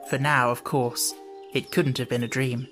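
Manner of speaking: usual speaking voice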